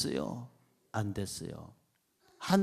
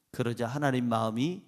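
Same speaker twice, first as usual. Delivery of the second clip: normal talking voice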